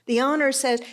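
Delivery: normal talking voice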